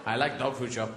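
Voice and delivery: low, contented dog voice. Acted. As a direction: drop low like a dog confiding its wish. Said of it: "The dog goes".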